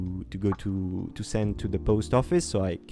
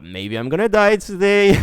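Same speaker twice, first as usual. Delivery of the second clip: loud, high, hammed-up realization; mock daredevil thrill; 8 semitones higher, 8 dB louder than usual. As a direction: call it out with giddy alarm. Mock thought before a risky jump.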